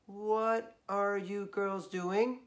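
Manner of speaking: slow, drawn-out questioning mom; suspicious mom. Acted. Mom questions the girls, slow and suspicious. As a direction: drag it out, suspicious.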